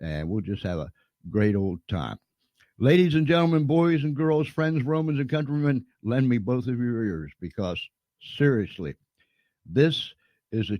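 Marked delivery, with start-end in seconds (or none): 2.8-7.3 mock announcer. Host's grand introduction of guest.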